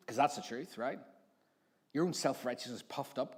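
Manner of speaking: ordinary talk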